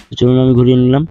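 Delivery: normal talking voice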